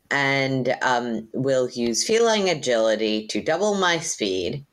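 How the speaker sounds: ordinary delivery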